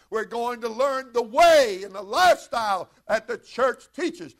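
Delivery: loud, lifted sermon cadence; preaching at full force. Acted. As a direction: drive it home to the congregation.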